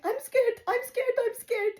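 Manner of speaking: high-pitched frightened whimpering imitation; mock panic. Imitating her friend panicking in the car boot, high scared voice. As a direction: repeat it in a high, panicky squeak. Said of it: "She's panicking".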